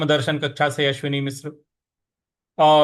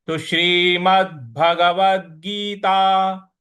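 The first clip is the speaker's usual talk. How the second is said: Shrimad Bhagavad Gita drawn out, final consonants stressed; slow recitation; 4 dB louder, much slower than usual. Shown as a demonstration. Teacher models the title slowly. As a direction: recite slowly with weighted endings. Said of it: "इस प्रकार से बोलेंगे".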